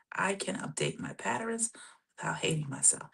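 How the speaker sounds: very soft, near-whispered self-talk; hushed affirmation. Shown as a demonstration. Coach letting listeners' bodies feel it, hushed affirmation. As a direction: let it sink in, barely voiced.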